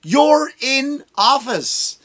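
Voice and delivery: high, slow, drawn-out exasperated yell; exasperated retort. Retold or imitated. Comic telling off a politician, high slow exasperated shout. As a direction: stretch it out high in disbelief. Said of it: "I'm like".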